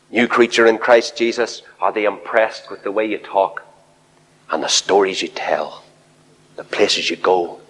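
normal talking voice

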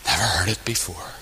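near-whispered, low repeat; very soft and low, letting it land; hushed. Real, not acted. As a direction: let it sink to a whisper for emphasis. Hushed repeat after tearful reaction.

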